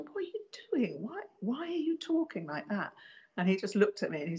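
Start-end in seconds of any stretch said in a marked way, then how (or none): 0.0-2.9 much higher, incredulous questioning; retold baffled challenge. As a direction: imitate yourself demanding an explanation, pitched right up. Retold challenge to oddly behaving man.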